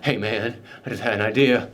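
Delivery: cult member. Acted. Cult member pitches an idea, low mock voice. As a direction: drop low for a touch of a dazed follower.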